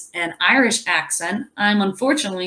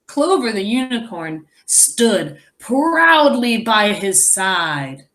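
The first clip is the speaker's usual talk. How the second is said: ordinary delivery, nothing marked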